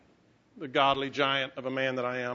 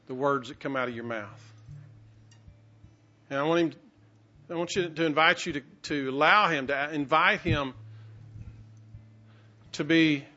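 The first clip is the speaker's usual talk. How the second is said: leading prayer. Acted. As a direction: guide the room gently into prayer. Pastor leads congregation in prayer.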